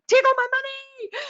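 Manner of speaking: high, loud mock-eager cry; eager fan. Host voicing a loyal fan's eager buying, high and loud. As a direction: hand over your wallet, gleefully.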